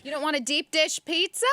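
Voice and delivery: a faint attempt at a Chicago accent; weak Chicago accent. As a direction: lightly put on a Chicago accent. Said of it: "I have to work on my Chicago accent".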